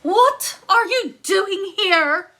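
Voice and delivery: high, loud, slow startled giantess; surprised giant woman, loud and high; giantess crying out. Acted. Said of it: "she cried".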